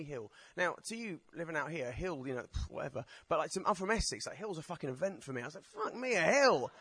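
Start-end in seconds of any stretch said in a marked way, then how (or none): 5.7-6.7 high, drawn-out mock amazement; comic wonder, pitched up; amazed exclamation. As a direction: exclaim it slowly, awestruck at the sight. Acts out amazement at a hill.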